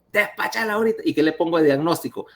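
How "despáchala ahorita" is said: put-on much higher, curt order from the old professor; bossy old professor. Old professor ordering the resident to discharge her, bossy. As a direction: snap the discharge order like a boss. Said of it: "me dice la, el viejo".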